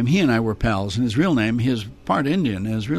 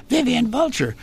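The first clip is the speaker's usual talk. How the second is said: Vivian Vulture; 12 semitones higher than usual. Shown as a demonstration. Puppeteer offers third, barely different choice, high falsetto. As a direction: squeal the name as a puppet. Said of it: "The third choice is".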